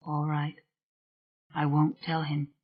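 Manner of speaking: soft, hushed agreement; whispered. A narrator's reading. Rowan to the spirit, a hushed reluctant promise. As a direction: give in softly, under the breath. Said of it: "she whispered".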